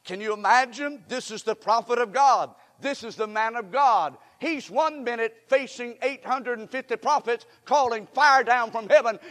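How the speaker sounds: ordinary talk, nothing marked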